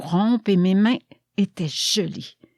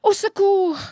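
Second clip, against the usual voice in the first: cry for help; 12 semitones higher than usual. A narrator's reading. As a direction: narrate a startled cry for help, voice leaping up. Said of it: "je m'étais éveillée en criant".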